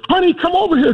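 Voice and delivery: high excited lady voice; older lady calling husband. Retold or imitated. Older lady calls her husband over, excited. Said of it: "she goes".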